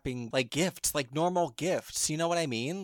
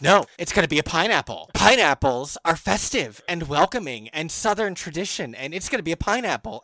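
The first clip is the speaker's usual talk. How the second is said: loud, emphatic mock-unhinged declaration; hammed-up unhinged insistence; unhinged decree; 3 semitones higher, 7 dB louder than usual. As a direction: imitate someone coming unhinged over a wild idea, louder and insistent. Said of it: "Eddie unhinges and decides".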